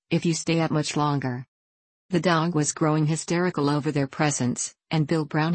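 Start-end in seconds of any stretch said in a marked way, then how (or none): none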